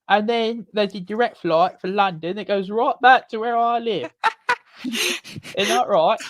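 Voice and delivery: hammed-up London English accent. Playing a Londoner while planning the trip home, mock London accent. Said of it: "I do like accents".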